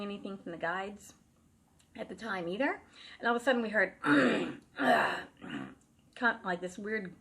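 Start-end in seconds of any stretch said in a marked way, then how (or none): 4.0-5.7 a low, rumbling male throat clear; deep throat clearing. Retold or imitated. Possessed sister clears her throat in a deep voice. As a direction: clear the throat like a gruff man taking over. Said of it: "husky male, deeper voice".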